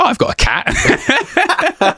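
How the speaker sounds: high, slow, laughing mock realisation; a hammed-up lightbulb moment, stretched out with a laugh; delighted eureka. Recalling her idea for the show, a high laughing eureka.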